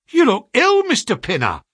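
high, loud cry of concern; shocked clerk to his employer; alarmed exclamation. A narrator's reading. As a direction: exclaim it, startled, starting way up. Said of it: "he exclaimed".

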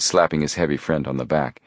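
usual speaking voice

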